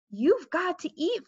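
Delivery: upset mother. A narrator's reading. Mother urging her little son to eat, mildly upset.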